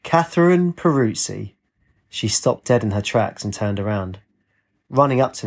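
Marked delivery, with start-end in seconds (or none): 0.0-1.5 slow, high call of a full name; shouted. A narrator's reading. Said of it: "Then the man shouted".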